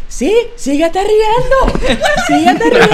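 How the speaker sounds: high, very slow sneering voice; mock the angry janitor taunting. Janitor taunting a laughing student, sneering mock voice. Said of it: "el tipo estaba enojado".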